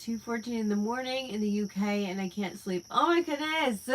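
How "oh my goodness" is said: surprised. Real, not acted. Reacting to a late-night comment.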